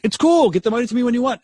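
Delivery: high, loud mock-breezy reply; sudden backpedal. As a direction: squeak out an over-eager climbdown. Abrupt backpedal after yelling at boss.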